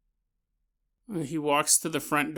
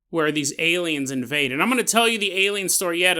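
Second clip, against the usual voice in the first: ordinary talk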